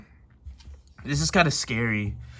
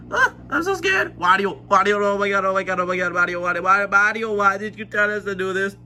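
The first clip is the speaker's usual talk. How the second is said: panicky, higher-pitched pleading; scared; 7 semitones higher, 4 dB louder, faster than usual. Acted. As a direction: babble in mounting fright.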